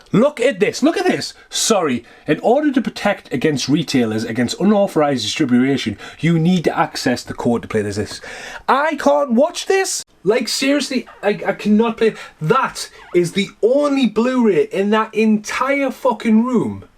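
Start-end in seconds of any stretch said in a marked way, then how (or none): 0.1-1.3 exasperated. Real, not acted. Disc won't play, points it out. 8.7-16.9 exasperated rant. Real, not acted. Ranting about locked Blu-ray.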